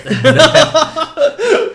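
high, loud burst of giggling; real laughter. Real, not acted. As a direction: crack up at your own joke. Laughing at own gift joke.

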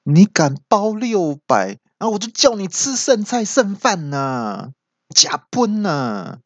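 high, drawn-out mock outrage; indignant groom. Groom threatens friend over six hundred, indignant. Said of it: "听到就很生气".

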